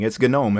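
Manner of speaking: gnome said guh-NOME; two-syllable "gnome", guh-nome; insistent hard G. Shown as a demonstration. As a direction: sound the G in gnome as its own syllable. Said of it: "It's not that confusing".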